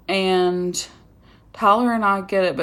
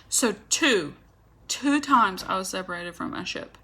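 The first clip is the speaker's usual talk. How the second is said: ordinary delivery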